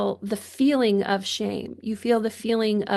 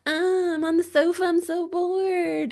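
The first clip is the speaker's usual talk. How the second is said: acted boredom; 8 semitones higher than usual. Coach showing people how to get bored, mock-bored whine. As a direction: play really bored, pitched up and stretched out. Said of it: "like, really bored".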